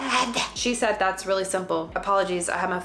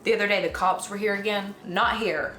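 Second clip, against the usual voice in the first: ordinary delivery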